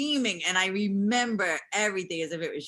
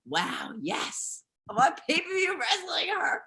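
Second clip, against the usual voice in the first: retold excitement; 8 semitones higher, slower than usual.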